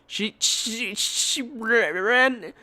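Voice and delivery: imitating her own crying stammer. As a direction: stammer through tears. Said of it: "I start crying".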